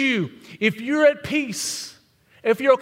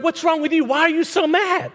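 mock incredulous; 6 semitones higher than usual. Quoting angry world.

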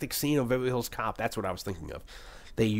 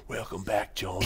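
lightly voiced villain, softer; villain's welcome; 2 semitones lower, 5 dB quieter than usual. Villain looking up to greet John, lightly voiced impression. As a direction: put on a hint of the villain greeting his rival. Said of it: "looking up, and he's like".

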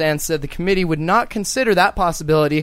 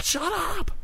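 high mock yelp; 12 semitones higher, 8 dB quieter than usual. Mock yelling at hecklers.

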